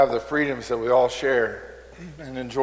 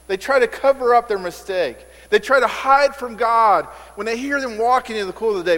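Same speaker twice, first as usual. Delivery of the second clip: preaching at full force; 8 semitones higher, 4 dB louder than usual. Acted. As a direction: proclaim it to the whole church.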